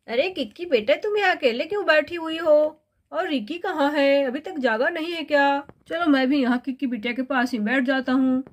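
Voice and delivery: a father chatting with his daughter; father character. Acted. Voices the father, Samar ji. (पिता की आवाज़)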